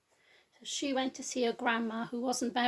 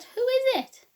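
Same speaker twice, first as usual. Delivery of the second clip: put-on high, loud old lady call; Grandma calling to the door; 13 semitones higher, 10 dB louder than usual. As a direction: call out from bed, much higher and louder. Grandma calls from bed.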